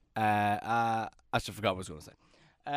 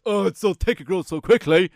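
higher and louder, preening over his thick hair; mock self-admiring; 3 semitones higher, 8 dB louder than usual. Admiring his own thick hair in the mirror, hammed-up preening. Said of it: "I'm like".

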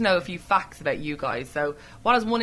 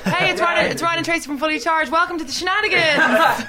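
loud, high announcer hype; excited presenter shout with a laugh; mock radio intro; 7 semitones higher, 6 dB louder than usual. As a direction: hype up the band like a radio host. Mock radio intro to band interview.

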